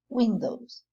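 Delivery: ordinary talk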